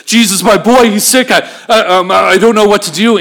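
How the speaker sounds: high, loud, stumbling plea; acted panic. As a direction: act out a panicked father at a loss. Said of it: "He's just in a panic".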